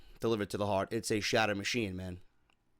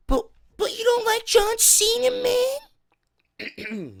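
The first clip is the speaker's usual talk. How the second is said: very high, loud squealed Goon voice; 10 dB louder, much slower than usual. Acted. Reading a fan's letter on request as his eight-year-old self, in Goon voice. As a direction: squeal it far above your own pitch, loudly. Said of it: "read that in the Goon voice".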